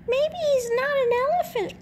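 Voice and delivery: squeaky fast hen voice; clucking smaller chicken. A narrator's reading. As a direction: cluck it quick like a flustered hen. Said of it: "clucked the smaller chicken".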